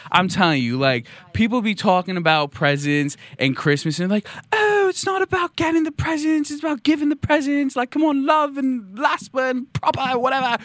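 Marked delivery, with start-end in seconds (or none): 4.5-10.6 very high, hammed-up British preachiness; mock British accent. Host mimicking people moralising about presents, mock British accent. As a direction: put on a squeaky, silly British voice. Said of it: "I don't know why they're British".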